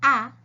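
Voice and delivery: ah, short open vowel; short a sound. Shown as a demonstration. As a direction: clip it to a short ah. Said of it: "the short sound of the letter A".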